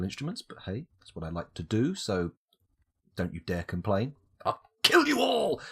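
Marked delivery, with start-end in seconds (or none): 4.4-5.6 high, slow, hammed-up threat; mock villain shout. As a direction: bellow it like a cartoon villain. Mock villain outburst at viewers.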